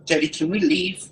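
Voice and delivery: put-on high, whiny child voice; the daughter begging her dad, high and whiny; whiny little daughter. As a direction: whine like a bored kid at a bar. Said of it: "She's all mopey faced".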